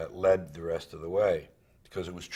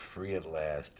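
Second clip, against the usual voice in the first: ironic quote; 5 dB quieter, slower than usual. Shown as a demonstration.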